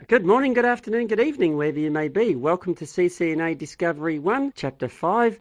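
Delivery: high, loud, fast radio-host welcome; excited greeting. Acted. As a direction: boom it out like a morning DJ. Teacher's big radio-style opening.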